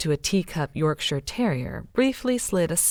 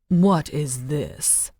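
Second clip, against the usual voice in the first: very slow, spitting contempt; contemptuous; much slower than usual. A narrator's reading. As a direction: spit each word out in disgust. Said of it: "Lilith spat with contempt".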